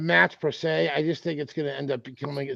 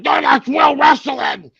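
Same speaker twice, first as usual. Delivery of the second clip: high, loud, slow outraged protest; hammed-up outrage, pitched way up and drawn out; mock indignant shout; 12 semitones higher, 11 dB louder, slower than usual. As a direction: shout it like an offended purist. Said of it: "Forbes was like".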